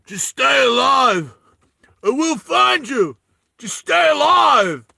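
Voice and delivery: high, slow, drawn-out shout; hammed-up movie hero's desperate call; Daniel Day-Lewis. Film hero shouting to his beloved to survive, hammed-up plea. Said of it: "Daniel Day-Lewis".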